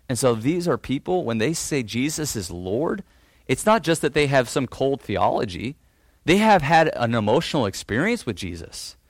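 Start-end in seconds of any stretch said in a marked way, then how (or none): none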